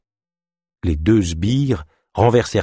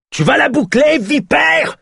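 much higher, louder furious roar; 5 dB louder than usual. Acted. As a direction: bellow her into silence. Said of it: "rugit Ézoc".

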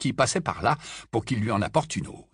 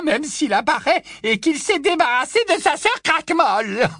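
put-on high, loud old busybody voice; a gossiping old great-aunt, pitched way up; high loud tipsy old aunt gushing; old aunt Muriel; 5 dB louder than usual. Muriel mocking, laughing.

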